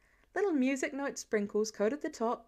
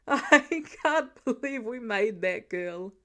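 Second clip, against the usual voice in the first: slow, louder, still laughing; 6 dB louder, slower than usual. A narrator's reading. Clint to Laura about their daughter, still laughing. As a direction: get it out through the giggles.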